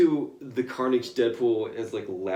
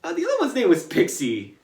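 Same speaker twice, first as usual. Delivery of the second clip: high, mocking aside; snarky correction; 4 semitones higher, 4 dB louder than usual. Deadpool needling Professor X, high and snide. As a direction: correct him smugly. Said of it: "Deadpool's like".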